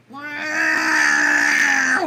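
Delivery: high shouted meow; shouted cat meow. Retold or imitated. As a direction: yowl it out like an annoyed cat. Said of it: "he'd say".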